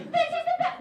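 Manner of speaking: very high, loud, delighted; high thrilled squeal. Retold or imitated. Girls amped by the game, high thrilled squeal. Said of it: "We are like".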